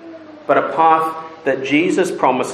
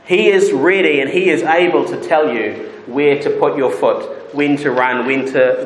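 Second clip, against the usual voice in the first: normal talking voice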